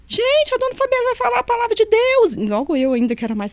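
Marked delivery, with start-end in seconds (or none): 0.1-2.3 high, loud announcement to everyone; calling out. Retold or imitated. Inmate shouting to the cellblock, high loud call. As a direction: call the whole block together, high and loud. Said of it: "Aí ela gritou".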